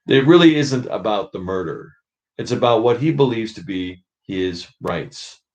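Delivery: usual speaking voice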